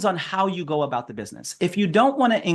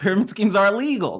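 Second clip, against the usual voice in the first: ordinary talk, nothing marked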